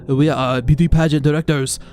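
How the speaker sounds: loud put-on broken English. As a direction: announce it in stiff broken English. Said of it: "said in broken English".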